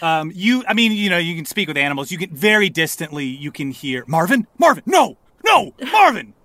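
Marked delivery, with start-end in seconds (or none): 4.1-6.3 high, urgent cries to a friend; frantic high little animal voice; alarmed animal cry. Acted. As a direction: call out in high-pitched alarm.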